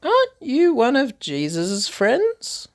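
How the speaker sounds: high, slow, curious asking voice; questioning bystander. Acted. Stranger at the fire questions Peter, high and probing. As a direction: lean in and ask him pointedly.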